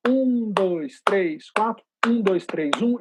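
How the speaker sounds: four even beats, then three twice as quick; steady musical counting, long beats then short ones; rhythmic count. Shown as a demonstration. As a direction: chant the count in strict time, doubling speed on the second group. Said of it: "duas vezes mais rápida".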